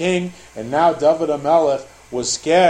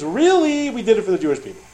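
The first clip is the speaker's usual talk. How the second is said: high, insistent mock protest; put-on pleading justification; making excuses; 6 semitones higher, faster than usual. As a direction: start very high on an acted excuse, easing down. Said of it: "they'll come up with a whole bunch".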